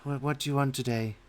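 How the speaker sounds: barmaid voice. Acted.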